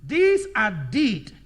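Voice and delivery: loud, slow, each word held; slow dictation. Shown as a demonstration. Teacher repeating it for the last time, slow dictation. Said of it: "For the last time".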